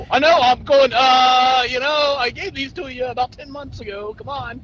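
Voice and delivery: high, loud, exasperated teasing; excited. Real, not acted. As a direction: playfully push him, loud and high. Friend teases about unused tickets.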